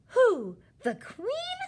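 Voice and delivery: gasp, then high-pitched shocked question; sharp intake, loud incredulous cry; aghast. A narrator's reading. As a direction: gasp and exclaim in horror.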